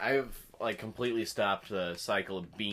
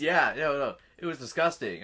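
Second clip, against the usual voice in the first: loud and disgusted; high, loud grossed-out protest; disgusted outburst; 5 semitones higher, 9 dB louder than usual. Real, not acted. Disgusted at bean spitting.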